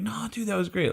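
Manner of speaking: high, soft, unconvincing praise; false reassurance. Retold or imitated. Musicians to comic who bombed, falsely reassuring. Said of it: "you're being dishonest with me".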